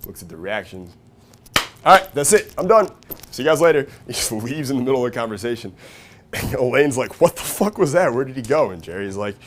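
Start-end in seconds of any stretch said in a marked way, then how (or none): 1.8-3.9 loud, high-pitched sign-off; triumphant exit announcement. Retold or imitated. George quitting the meeting on a high note, loudly triumphant. 7.2-8.7 mock loud, high-pitched disbelief; baffled outburst. Elaine reacting to George walking out, baffled mock outburst.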